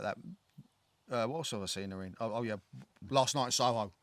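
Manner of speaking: ordinary talk, nothing marked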